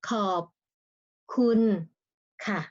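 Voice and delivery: ขอบ คุณ ค่ะ split into separate tone-marked syllables; ขอบ คุณ ค่ะ one syllable at a time. Shown as a demonstration.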